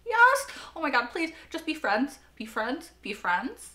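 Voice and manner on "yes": loud, high burst of joy; excited cheer. Real, not acted. Viewer cheering as old friends reunite, thrilled. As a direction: cheer like your team scored.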